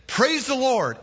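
high-pitched, eager send-off; eager call to horse. Acted. Rider starting out on his new horse, high eager call. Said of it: "And he said".

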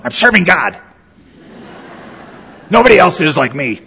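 lightly voiced grump boasting about his work; cranky servant. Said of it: "cranky servants of God".

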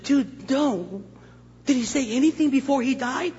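high, shocked shop owner. Retold or imitated. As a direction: gasp it out in shock.

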